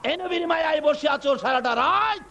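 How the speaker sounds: very high, ringing sermon cry; full-force preaching. Acted. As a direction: cry it out high, stretching the phrase ends. (চড়া সুরে ওয়াজ)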